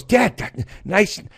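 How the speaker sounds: put-on high child's voice; kid voice. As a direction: say it like a small boy impressed.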